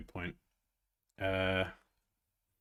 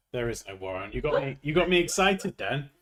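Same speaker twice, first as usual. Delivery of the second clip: normal talking voice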